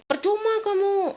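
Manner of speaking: high, mocking scold imitating husband; quoting husband in a high mocking voice. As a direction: throw his dismissive words back at him.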